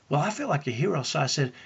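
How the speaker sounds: ordinary delivery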